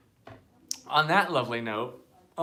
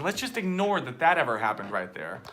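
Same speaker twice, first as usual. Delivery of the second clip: ordinary talk, nothing marked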